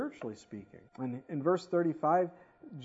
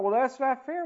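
high, louder, petulant protest; mock complaint; 9 semitones higher, 6 dB louder than usual. The doubter protesting being cast out, mock petulance. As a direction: whine it like a sulking kid.

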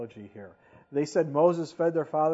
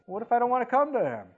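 higher, faster, a mock-defiant challenge; whiny objection; 8 semitones higher, 7 dB louder, faster than usual. A doubting listener challenging the preacher, mock defiance. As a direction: play the stubborn doubter, quick and high.

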